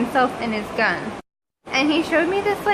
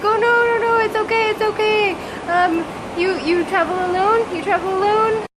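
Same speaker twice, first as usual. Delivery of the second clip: higher, eager mock-soothing man's voice; imitating soothing driver; 9 semitones higher, 3 dB louder than usual. Driver calming the crying passenger in his car, higher mock-soothing voice. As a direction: hurry to calm a crying stranger, pitched up.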